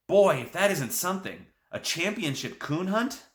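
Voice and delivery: amazed. A narrator's reading. Boy amazed by the news.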